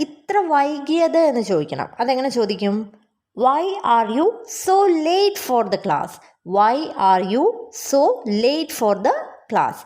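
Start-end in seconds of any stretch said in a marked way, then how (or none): 3.4-9.8 slow, measured question for learners; slow model sentence. Shown as a demonstration. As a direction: say it slowly, phrase by phrase. Teacher models English question slowly.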